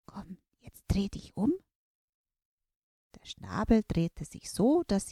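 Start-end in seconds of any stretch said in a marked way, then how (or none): 0.1-1.5 voiceless whisper. Acted. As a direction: drop to a secret whisper. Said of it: "werde ich ganz leise". (ganz leises Flüstern)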